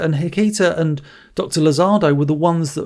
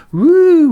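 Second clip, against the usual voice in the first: a sudden high, loud "woo" like a cartoon time jump; mock whoosh; 12 semitones higher, 7 dB louder than usual. Podcaster mocking a film's flashback, loud high woo. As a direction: sweep up into a shouted woo as the scene flips.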